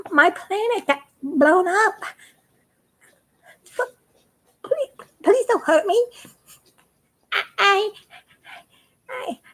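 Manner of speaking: a frightened tiny alien begging not to be hurt; timid alien. Retold or imitated. Scared alien embryo pleads with the astronaut, high and stammering. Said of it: "comes out and goes".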